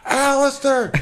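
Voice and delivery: put-on high, slow child's voice calling to a friend; lightly voiced kid calling his friend Alistair. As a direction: call across the street like a little kid. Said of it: "He goes".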